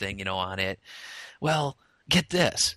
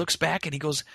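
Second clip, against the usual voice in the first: usual speaking voice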